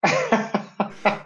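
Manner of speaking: short high-pitched laugh. Real, not acted. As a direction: burst out laughing. Riso da piada do colega. (risada aguda)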